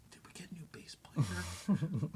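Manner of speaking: slow, whispered stage aside; mock whisper. Pretending to ask who the new bass player is, whispered. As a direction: whisper it like gossip.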